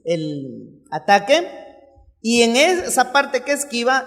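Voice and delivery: normal talking voice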